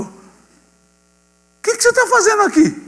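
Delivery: high, astonished what-are-you-doing-here; surprised question. Retold or imitated. Varig manager startled to see the pastor back, high surprised. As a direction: pitch it way up in shock.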